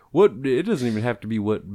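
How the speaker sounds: ordinary talk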